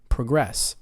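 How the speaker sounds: proGRESS, weak first syllable; "Progress" as a verb, stressed on GRESS; second-syllable stress. Shown as a demonstration. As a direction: land on the end of "Progress". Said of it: "verbs on the second".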